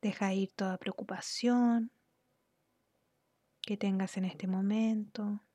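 unhurried, soothing instructions that ease the listener into relaxation; guided meditation. Real, not acted. Guide leads listener through relaxing the body, calm guided meditation.

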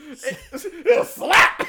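loud, sudden one-word outburst; mock yell. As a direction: imitate the yelled slap. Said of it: "He actually yells the word".

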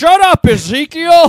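screamed, drawn-out "Shut up, Ezekiel"; mock dad yell. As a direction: bellow it like an angry dad. Cohost voicing dad yelling at son.

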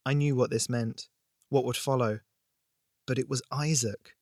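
ordinary delivery, nothing marked

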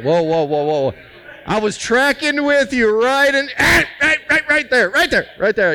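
hammed-up "right there, right there"; mock startled listener. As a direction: play the guy who just got offended. Mocking a listener's startled objection.

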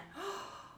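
sharp, high, breathy shocked gasp; a soft mock gasp of outrage; mimicked gasp. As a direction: imitate the moms' scandalized intake of breath. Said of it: "these two moms went".

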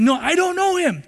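high, pleading final denial; desperate denial. Acted. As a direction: deny it with panic rising. Peter's third, final denial.

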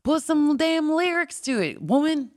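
gruff Southern guitarist, teasing; Southern accent. Retold or imitated. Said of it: "he had this really thick Southern accent".

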